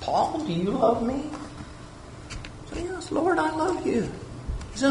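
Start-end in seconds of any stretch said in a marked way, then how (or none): 0.0-1.3 much softer, higher, gentle asking; tender question. Acted. Jesus asks the speaker. 2.9-4.0 much higher and much softer, earnest; eager answer. Acted. Speaker answers as himself.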